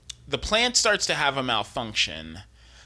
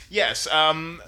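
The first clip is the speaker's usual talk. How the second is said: usual speaking voice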